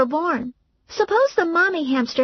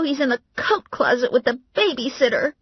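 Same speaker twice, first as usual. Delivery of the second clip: crying; 10 semitones higher than usual. Acted. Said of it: "Valerie breaks down".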